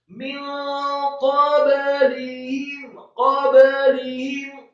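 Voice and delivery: slow chanted word with stretched syllables; Quran recitation. Shown as a demonstration. Teacher models the word for learners, Quran recitation.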